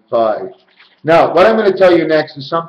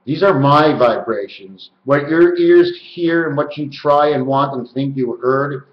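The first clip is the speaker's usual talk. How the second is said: usual speaking voice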